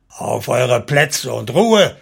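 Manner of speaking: slow, loud military order; commanding shout. A narrator's reading. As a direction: shout over the uproar, stretching each word. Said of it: "überschrie der Feldherr diese Zurufe".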